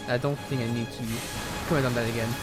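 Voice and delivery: usual speaking voice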